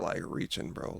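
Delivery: hushed, breathy whisper; whispered aside. Real, not acted. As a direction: drop to a whisper. Skeptical aside about ghosts.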